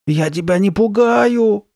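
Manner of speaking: high, loud, drawn-out protest; slow high-pitched yell; crying out. A narrator's reading. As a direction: shout it desperately, stretching the words. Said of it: "закричала Карли Бет".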